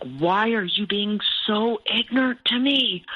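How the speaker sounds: high, drawn-out mock scream at him; retold scream. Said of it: "I started screaming at him".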